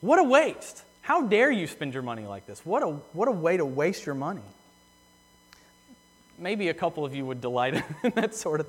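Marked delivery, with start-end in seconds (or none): 0.0-4.4 wag a finger and let them have it; scolding a stranger over wasted money, loud and indignant. Retold or imitated. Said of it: "shaking your finger in their face and saying".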